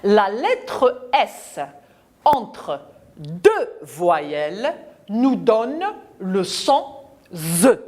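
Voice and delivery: put-on slow, spaced rule for the children to repeat; teacher spells out the rule word by word; slow dictation. Shown as a demonstration. Teacher dictates the rule slowly to the class. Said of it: "je retiens".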